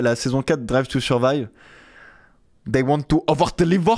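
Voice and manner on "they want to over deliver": put-on German-Italian accented English, pitched up; put-on German-Italian accent, a bit higher; Gunther's accent. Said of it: "l'accent de Gunther est pas facile à faire".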